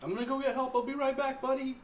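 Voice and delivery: high, loud in-character shout; calling back loudly. Acted. Player answers guard while walking off for help, calling back loudly. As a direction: call back over the distance to a buddy.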